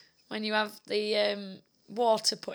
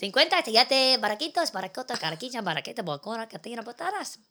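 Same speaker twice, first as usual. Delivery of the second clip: fake foreign language. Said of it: "No, that's not Spanish".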